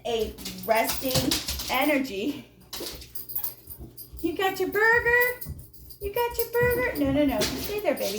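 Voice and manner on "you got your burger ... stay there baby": high sing-song voice for a pet; pet talk. Real, not acted. Reader talking to her dog, high pet voice. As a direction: coo at the dog over its burger.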